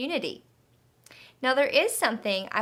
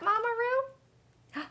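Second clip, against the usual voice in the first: puzzled question; 12 semitones higher, 4 dB quieter, much slower than usual. Acted. Asking the puppet a question.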